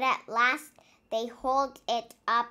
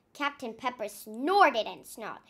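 ordinary delivery, nothing marked

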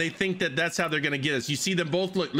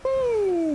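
high whoop; 4 dB louder than usual. Real, not acted. Cheer while sailing.

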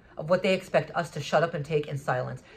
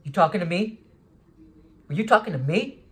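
louder, rising confrontational challenge; angry challenge; 2 semitones higher, 5 dB louder than usual. Retold or imitated. Angry stranger in the store confronting the speaker, loud challenge. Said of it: "he looked genuinely angry".

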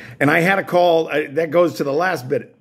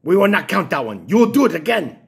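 stern technician; 5 semitones higher than usual. Retold or imitated. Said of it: "So she said".

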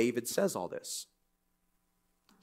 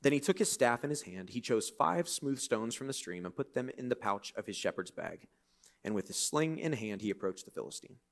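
calm, fading reading of the passage; scripture reading; 3 semitones lower, 7 dB quieter than usual. Acted. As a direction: read it like the Bible story. Reads scripture aloud.

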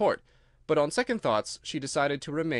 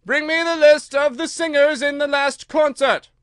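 very high, loud royal command; imperious queen; 12 semitones higher, 11 dB louder than usual. A narrator's reading. Queen orders an officer of the court, high imperious shout.

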